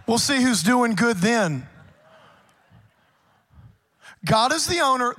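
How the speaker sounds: loud, driving sermon delivery; preaching at full force. Acted. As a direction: preach it hard to the congregation.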